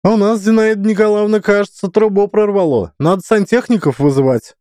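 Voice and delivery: high, fast yapping; yappy small dog. A narrator's reading. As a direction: yap it like a lapdog. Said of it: "протявкала директриса".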